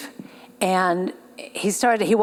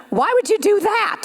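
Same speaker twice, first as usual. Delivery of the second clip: high, startled disbelief; incredulous question; 13 semitones higher than usual. Retold or imitated. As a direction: ask it as if she can't believe it. Stranger refuses a bought coffee.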